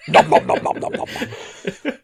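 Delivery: greedy cartoon monster munching; loud, voiceless chomping, trailing into laughter; Cookie Monster. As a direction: gobble noisily like a puppet monster. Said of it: "Cookie Monster nearly".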